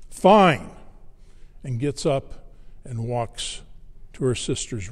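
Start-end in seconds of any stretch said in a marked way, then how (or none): 0.1-0.7 put-on loud, high, snippy teenage retort; one sharp, snippy word. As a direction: imitate a teenager giving in snippily. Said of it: "in her snippiest voice".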